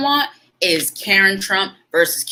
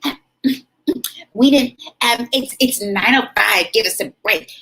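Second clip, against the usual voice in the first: mock Karen; 6 semitones higher than usual. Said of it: "And she's like".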